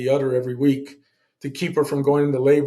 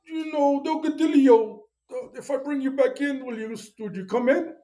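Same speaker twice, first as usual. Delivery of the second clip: mock recruiter, pitched much higher; retelling the recruiter's pitch in a raised mock voice; imitating the recruiter; 11 semitones higher than usual. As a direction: play the eager recruiter, way up high. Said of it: "And he goes".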